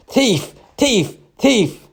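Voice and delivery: very high, sharp screamed accusation; screaming crowd. A narrator's reading. Crowd accusing a woman over and over, screaming. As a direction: scream each word separately, pitch plunging. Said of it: "screaming over and over".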